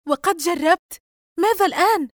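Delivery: high, loud, worked-up demand; agitated. A narrator's reading. Yusra demands of Dina what now, agitated. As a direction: burst out with agitation. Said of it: "يسرى بانفعال".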